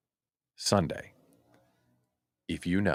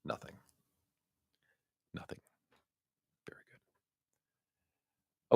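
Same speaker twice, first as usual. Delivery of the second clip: whispering; 13 dB quieter, slower than usual. Real, not acted. Whispered aside, unvoiced.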